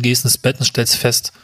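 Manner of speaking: ordinary talk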